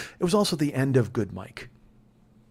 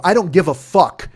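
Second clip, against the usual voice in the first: loud, higher, punched refusal; angry dismissal; 5 semitones higher, 9 dB louder than usual. Retold or imitated. Driver refuses woman's plea to get home, angry dismissal. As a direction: throw the plea back angrily.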